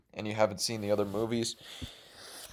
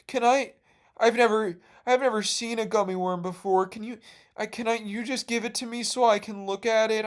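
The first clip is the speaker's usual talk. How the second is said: put-on much higher pleading little boy voice; whiny kid Raymond; 9 semitones higher than usual. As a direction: beg sweetly for the gummy worm. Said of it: "and he's like".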